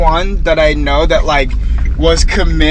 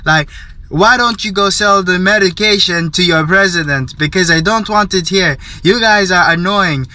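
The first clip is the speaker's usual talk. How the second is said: mock African accent, raised pitch, ranting; imitated African accent; 4 semitones higher than usual. Stall neighbour ranting at scam caller, imitated African accent. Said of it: "the African, uh, accent and everything".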